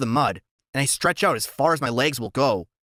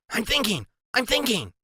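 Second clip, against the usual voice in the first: Freak's high voice; 9 semitones higher than usual. A narrator's reading. Sidekick stalling while his friend panics. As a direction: mutter it high while working it out.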